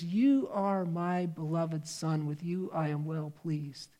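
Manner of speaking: hushed slow blessing from heaven; tender heavenly voice. Acted. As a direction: let it fall softly and slowly, like a father's benediction.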